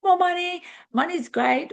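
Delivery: hammed-up greedy excitement; eager quote. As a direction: imitate people craving money, sky-high and bright. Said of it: "everyone wants to go".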